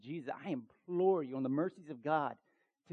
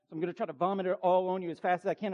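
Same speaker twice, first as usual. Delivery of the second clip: fast talking; 3 semitones higher, faster than usual. Real, not acted. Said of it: "I'm talking as fast as time is right now".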